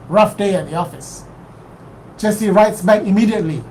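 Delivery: ordinary delivery, nothing marked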